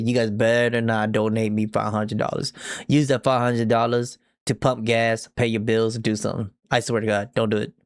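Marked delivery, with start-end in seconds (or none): none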